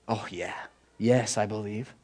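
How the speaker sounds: soft, low near-whisper; hushed affirmation. Real, not acted. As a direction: answer yourself quietly and low. Answers own question about believing.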